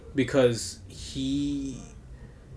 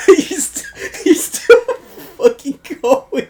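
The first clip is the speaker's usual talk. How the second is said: laughing hard; 10 dB louder, slower than usual. Real, not acted. Still laughing at cohost.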